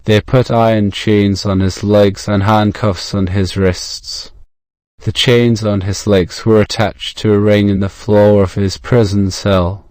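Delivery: usual speaking voice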